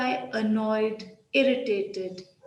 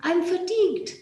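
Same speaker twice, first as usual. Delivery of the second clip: "I'm fatigued." said much higher, crisp and proud; bright, higher demo line; 8 semitones higher than usual. Shown as a demonstration. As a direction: lift your voice on "I'm fatigued" like a smart upgrade. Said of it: "you could say".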